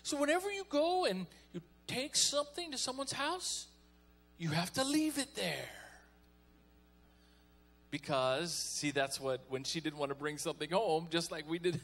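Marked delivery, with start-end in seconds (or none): 0.0-5.7 mom voice. Retold or imitated. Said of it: "She goes".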